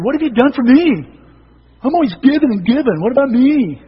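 high, loud indignant whine; loud selfish complainer. Pretend. Preacher voicing the congregation's selfish attitude, loud and whiny. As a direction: demand it like a put-upon martyr. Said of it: "And we have that attitude".

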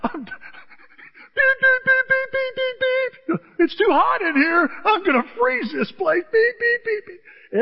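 high, loud hammed-up complainer jabbing the buttons; mock grumbling with high beep noises; fussing thermostat user. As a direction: play the cranky guy at the thermostat. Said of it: "How many times you go".

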